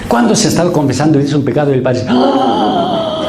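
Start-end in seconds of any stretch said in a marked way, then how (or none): 2.1-3.2 high, sudden shocked cry of "¡Ah!"; a mock gasp of horror, pitched way up; shocked priest yelp. Priest reacts to a sin.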